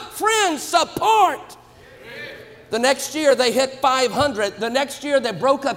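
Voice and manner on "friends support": higher, very slow, shouted; slow shouted emphasis. Real, not acted. Preacher driving home to the congregation, slow shout. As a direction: preach it out word by word. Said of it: "preaching emphasis".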